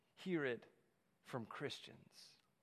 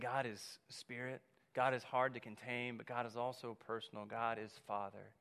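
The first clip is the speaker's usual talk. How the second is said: soft, lower, unhurried sermon voice; quiet intimate; 6 semitones lower, 7 dB quieter than usual. Acted. Preacher voicing Jesus softly to the congregation.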